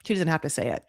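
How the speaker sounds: ordinary talk, nothing marked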